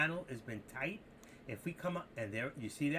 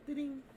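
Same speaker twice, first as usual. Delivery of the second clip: high sung ding-ding like a bell; playful doorbell chime; doorbell imitation; 12 semitones higher than usual.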